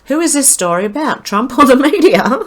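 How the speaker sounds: high incredulous question tipping into laughter; real amused disbelief, pitched high; breaking into laughter. Real, not acted.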